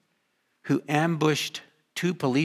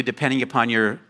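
ordinary delivery

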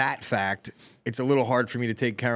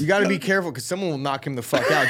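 ordinary delivery

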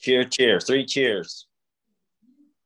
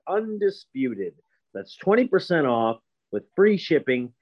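ad read. Acted. Ad read with promo code.

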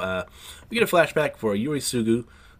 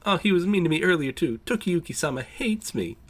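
higher mock-whiny wail; hammed-up crocodile tears, pitched up; boy fakes tears to the adults, mock whiny and higher; fake crying; 7 semitones higher than usual. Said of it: "He starts crying, says".

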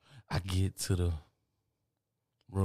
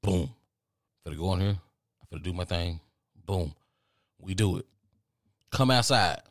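normal talking voice

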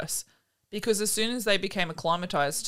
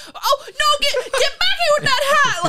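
sudden high, loud mock rage; imitating mad yelling; 10 dB louder than usual. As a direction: explode at a thieving pet. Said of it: "Like, he was so mad".

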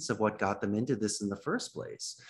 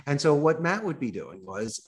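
ordinary talk

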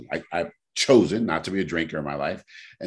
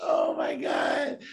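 very high, slow, squeaky laugh-cry; 5 dB quieter, much slower than usual. Real, not acted. After his friend gloats about the prank, squealing with laughter. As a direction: let it squeal out through the giggles.

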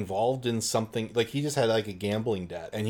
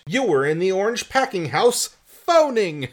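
accusing cry, a good deal higher and louder; cried out; 8 semitones higher, 7 dB louder than usual. Acted. George catches Anton in a lie, loud accusing cry. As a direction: call him out, catching a liar. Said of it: "George cried out".

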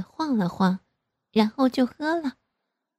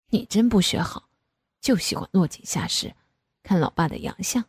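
father's voice. Acted.

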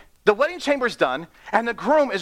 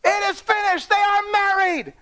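high, excited proclamation; best man's announcement; 10 semitones higher than usual. Retold or imitated. Best man telling the wedding party, high excited announcement. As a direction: burst into the party with the news, pitched high. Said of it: "he would go".

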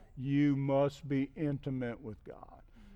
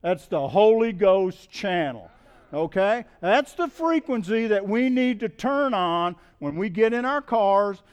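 loud, higher-pitched sermon delivery; impassioned preaching; 4 semitones higher, 9 dB louder than usual. Real, not acted. Preacher urging congregation to tune in to God, loud and fervent. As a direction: drive the message home forcefully to the congregation.

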